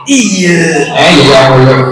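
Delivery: lightly voiced puppet character; puppet Afa's voice. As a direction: answer as the cheeky puppet. Puppet Afa answers.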